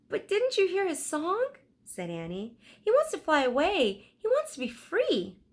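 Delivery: put-on high, earnest girl's voice; lightly voiced eager girl reminding her brother; young girl Annie. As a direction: Annie insisting to Jack, earnest. Annie pleading for bird.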